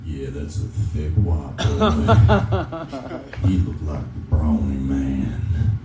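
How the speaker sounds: put-on creepy southern accent, low and slow. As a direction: leer it out slowly like a creepy southern guy. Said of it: "a guy with a southern accent just being like".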